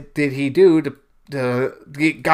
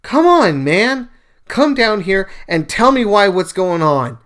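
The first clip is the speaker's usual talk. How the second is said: loud, higher exasperated plea, short of screaming; retold scream at God; 5 semitones higher, 6 dB louder than usual. A believer demands answers from God, exasperated calling out. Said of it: "I scream at God and go".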